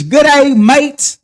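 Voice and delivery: loud, high mock British greeting; mock British accent. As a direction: call it out in a put-on British accent. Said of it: "in that good old British".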